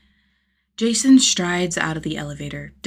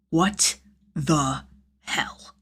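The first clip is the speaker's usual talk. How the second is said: stunned mutter; 4 semitones lower, 3 dB quieter, much slower than usual. Acted. Jason stunned by molasses.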